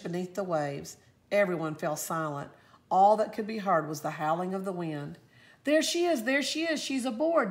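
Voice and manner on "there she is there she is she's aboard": much higher, louder joyful shout; excited cry. A narrator's reading. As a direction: cry out with relief as she's spotted. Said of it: "Davy cried out".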